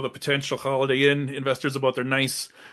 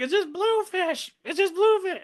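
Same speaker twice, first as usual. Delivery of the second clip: high mock bystander. Bystander voiced again.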